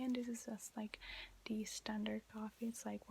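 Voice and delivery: usual speaking voice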